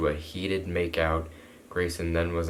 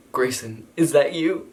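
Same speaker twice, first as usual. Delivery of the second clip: higher, louder searching call; acted tentative heroine; 7 semitones higher, 7 dB louder than usual. Girl calling to whoever is crying, tentative. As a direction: call out high, unsure who's there.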